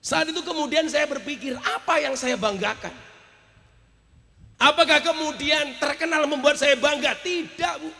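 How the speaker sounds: high, projected soul-searching question; preacher's forceful rhetorical questioning; impassioned preaching. Real, not acted. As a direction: raise the voice and challenge yourself before the congregation. Preaches with raised voice.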